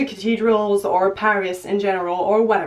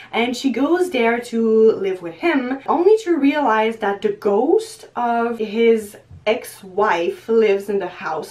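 normal talking voice

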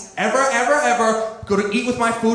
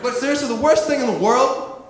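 normal talking voice

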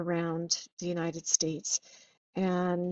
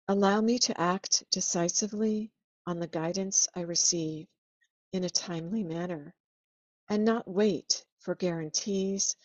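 read prayer. Real, not acted. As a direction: pray it sincerely, letting each line settle. Reads a prayer aloud.

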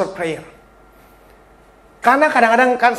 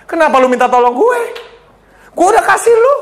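voicing God; 6 semitones higher, faster than usual. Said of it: "Terus Tuhan ngomong apa?".

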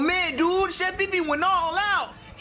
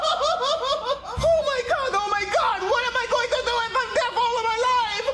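high, panicked comic wailing; crying; 9 semitones higher than usual. Acted. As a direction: wail in hammed-up despair. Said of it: "he's crying".

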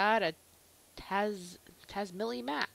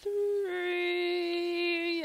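announcer voice; 6 semitones higher than usual. Acted. Intro in announcer voice.

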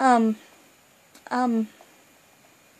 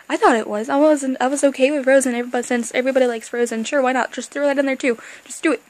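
louder, fast excited rant; 8 dB louder, faster than usual. Real, not acted. Fan ranting about which Disney worlds to add, loud and excited. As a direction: rant it out, all worked up.

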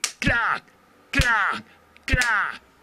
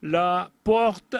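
plain reading voice

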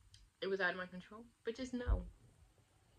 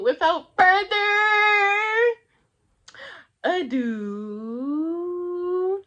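loud outro; 8 semitones higher, 13 dB louder, much slower than usual. Real, not acted.

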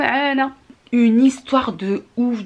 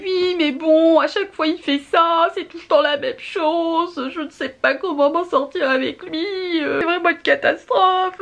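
lightly voiced fretful woman, higher; complaining stranger; 7 semitones higher than usual.

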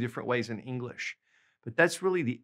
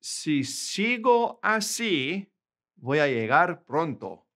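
slow model sentence; 3 semitones higher, slower than usual. Shown as a demonstration. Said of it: "I want to give you an example".

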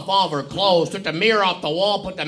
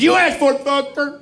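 cocky taunt; 6 semitones higher than usual. Retold or imitated. Kid taunting teacher.

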